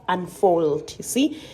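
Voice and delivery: normal talking voice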